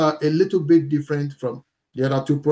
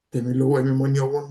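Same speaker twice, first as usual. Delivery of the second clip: verbose example; 2 semitones lower, much faster than usual. Shown as a demonstration. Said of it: "it's a kind of verbosity".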